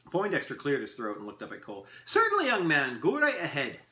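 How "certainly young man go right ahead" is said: high, loud, lilting consent; obliging elf. Acted. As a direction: grant it grandly. Elf grants a question.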